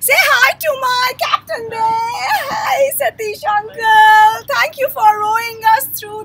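calling out. Real, not acted. Calling out to boatman.

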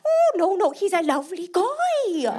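very high, bright Irish lilt; Irish Katie. Retold or imitated. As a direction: defend him in a sweet Irish voice. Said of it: "Katie is Irish".

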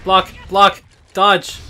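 high, loud frantic commands; frantic shouting. Real, not acted. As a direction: bark out urgent commands.

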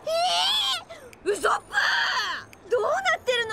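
high, loud cry of alarm. Acted. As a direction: scream for the vanished friend. Friend sucked into vortex. (驚いて叫ぶ)

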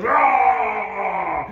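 high, long mock bear roar; drawn-out shouted roar, hammed-up. Bear acted smashing chair.

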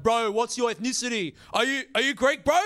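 lightly voiced excited Greek guy; excited Greek dude. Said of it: "Greek dudes coming up to me excited".

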